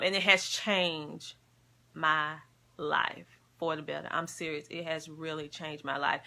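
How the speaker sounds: quiet, choked-up, holding back tears; tearful. Real, not acted. Vlogger to viewers, quietly fighting back real tears. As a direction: voice sinking as the tears come. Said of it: "Sorry for the ugly cry".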